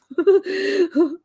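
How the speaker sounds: high laugh tipping into crying; tearful laugh. Real, not acted. Signing off to friends, laughing and crying. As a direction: laugh through the tears. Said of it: "guys, crying".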